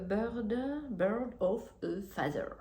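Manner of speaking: slow English title in a French accent. Real, not acted. Knitter naming an English shawl pattern, clumsy French-accented English. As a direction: read the English words haltingly with a French accent. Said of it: "mon accent anglais".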